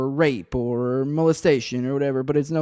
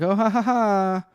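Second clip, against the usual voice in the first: higher, slow put-on ha-ha-ha; mock laugh; 6 semitones higher, slower than usual. Showing how their own joke would land, mock laughing. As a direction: draw out an exaggerated fake laugh.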